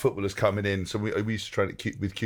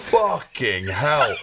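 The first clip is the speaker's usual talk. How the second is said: very slow, drawn-out amazed swearing; stunned disbelief; 4 dB louder, much slower than usual. Retold or imitated. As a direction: stretch out each word in retold astonishment. Retold shock at seeing his size.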